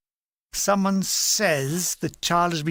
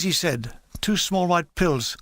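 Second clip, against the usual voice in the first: usual speaking voice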